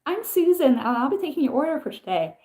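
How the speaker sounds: high, bubbly mock waitress greeting; chirpy server. Imitating an American server greeting a table, bubbly and high. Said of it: "and it's like".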